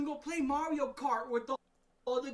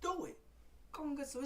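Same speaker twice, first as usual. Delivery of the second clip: ordinary talk, nothing marked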